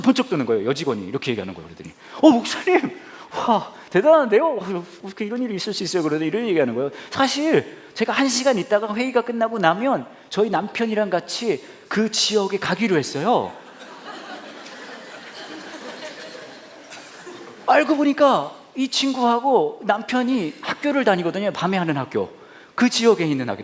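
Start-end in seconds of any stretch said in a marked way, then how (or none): 2.2-6.0 high, loud eager female staffer voice; excited staffer. Retold or imitated. Staffer bursting in on the pastor, high eager voice. 7.1-21.5 lightly raised, slow female voice; a hint of the eager staffer, slowed down; young woman's voice. Staffer telling the pastor her plan, lightly high voice.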